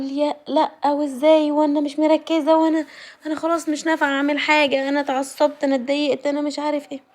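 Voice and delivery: high, flustered panicky whine; flustered self-talk. Retold or imitated. Retelling her panic when something fell from her hand, high and flustered. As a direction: fret at yourself over the thing you dropped. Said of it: "بقول لنفسي".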